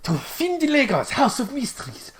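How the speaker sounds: high, grand merchant's welcome; shopkeeper voice. Acted. GM voicing the shopkeeper welcoming the party in. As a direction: greet the party like a showy dragonborn shopkeeper. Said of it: "And he's like".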